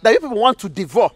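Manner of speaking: angry demand. Acted. Mother confronts the boys.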